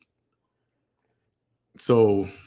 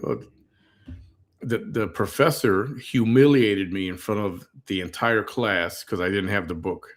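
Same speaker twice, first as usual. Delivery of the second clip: normal talking voice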